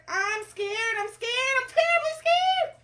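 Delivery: very high, loud, escalating wail; terribly scared cook. Acted. Cook declares his terror of fishing, hammed-up panic. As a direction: climb into hammed-up cartoon panic. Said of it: "the cook declared".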